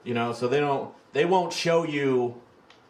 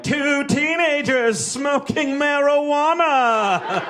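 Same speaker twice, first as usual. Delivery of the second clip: very high, loud, slow dramatic reveal; theatrical announcement; 7 dB louder, slower than usual. Retold or imitated. Youth pastor presenting the first sin scare, high hammed-up slow reveal. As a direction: unveil it like a spooky horror host.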